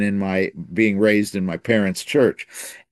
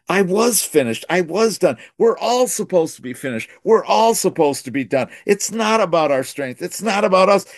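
higher, ringing preacher's fervor; preaching climax; 8 semitones higher than usual. Acted. Testimony to the congregation, rising preaching fervor. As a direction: lift it up like the sermon's peak.

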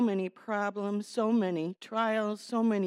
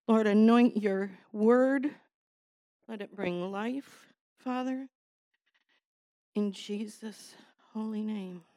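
short prayer; 3 dB louder than usual. Real, not acted. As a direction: ask God to anoint the word. Short prayer before preaching.

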